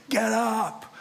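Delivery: imitating a captain barking a wake-up order; half-hearted shout of a ship captain; mock yell. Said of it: "this guy yelling in my face".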